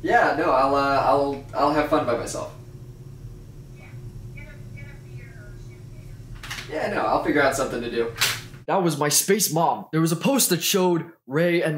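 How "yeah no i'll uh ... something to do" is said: mock phone call. I'm getting a phone call.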